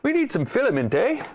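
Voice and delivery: high, lilting mock-Canadian; exaggerated Canadian twang; Canadian accent. Shown as a demonstration. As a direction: ham up the Canadian, lifting on "eh". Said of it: "Sound all Canadian".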